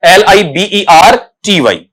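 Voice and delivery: slow letter-by-letter spelling; unhurried recital of the letters. Shown as a demonstration. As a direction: spell each letter out slowly. Spelling a word aloud.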